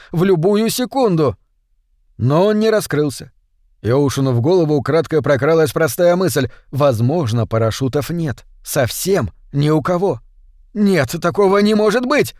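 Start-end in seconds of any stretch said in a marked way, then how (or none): 0.1-1.4 higher, more insistent pitch on the whole phrase; desperate self-persuasion. A narrator's reading. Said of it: "убеждал себя Оушен". 10.7-12.3 high-pitched, desperate disbelief, not full volume; inner scream of denial. A narrator's reading. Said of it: "вопил голос в голове Оушена".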